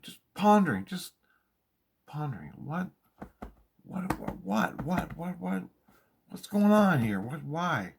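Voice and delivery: high, rising alarm from pondering to panic; frantic questioning. Retold or imitated. Agitated inner questioning builds.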